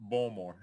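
"Baltimore" as two-syllable "Bawlmore"; swallowed middle. Retold or imitated. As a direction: echo "Baltimore" without its T. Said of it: "they say Baltimore".

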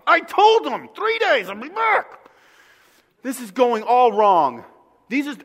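loud, high, frustrated venting; Jesus venting about his disciples, loud and frustrated; frustrated rant. Retold or imitated. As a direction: rant like a wronged teacher. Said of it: "that's how I would act".

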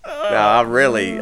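high wheezing laugh into the words; real laughter. Real, not acted. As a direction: crack up, then push on. Laughs at his own joke.

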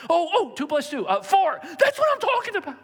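high, loud, gleeful teacher voice; excited teacher. Retold or imitated. A teacher cheering a pupil's right answer, high and gleeful. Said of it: "your teacher getting excited".

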